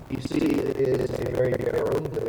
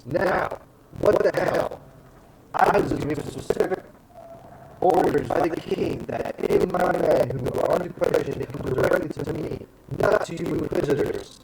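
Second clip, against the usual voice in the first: higher, indignant dressing-down; fierce commander; 4 semitones higher, 3 dB louder than usual. Acted. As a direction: pull rank, fierce and raised. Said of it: "the ferocity in her face".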